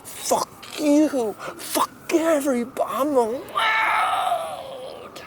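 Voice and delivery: rising to a high, slow shout; unhinged rant. Acted. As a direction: lose it and yell. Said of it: "losing his bolt marbles".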